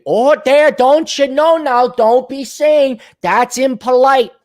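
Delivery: high, slow, singsong mock Minnesotan; Minnesota Scandinavian accent. Minnesotan chiding someone as impolite, mock Minnesota accent. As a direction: scold politely in a hammed-up Minnesota accent. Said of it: "that Minnesota culture, that Scandinavian".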